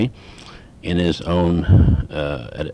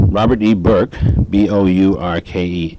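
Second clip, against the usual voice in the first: usual speaking voice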